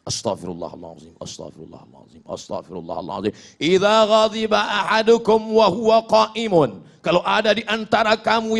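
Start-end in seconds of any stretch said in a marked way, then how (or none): 0.0-3.3 very low, much softer murmured repetitions; soft zikir. Shown as a demonstration. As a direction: whisper the istighfar to calm yourself.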